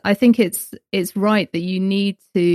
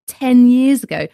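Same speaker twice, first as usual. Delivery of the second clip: ordinary talk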